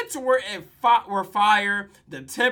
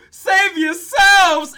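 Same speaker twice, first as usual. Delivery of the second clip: high, drawn-out loud cry; big comic shout of alarm, stretched out; mock panic shout; 11 semitones higher, 14 dB louder, much slower than usual. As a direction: yell it like a hammed-up hero fleeing. Acting teacher fleeing in panic.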